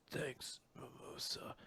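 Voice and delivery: hushed, nearly whispered thanks; weak murmur. Acted. Battered Asta thanking Mimosa, weak murmur. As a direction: let it out faintly, as if battered.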